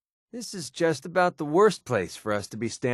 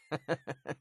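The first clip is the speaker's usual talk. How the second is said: chuckle; 3 semitones higher, 10 dB quieter than usual. Acted. Companion laughing at his own jibe, soft chuckle. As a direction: chuckle quietly at your own joke.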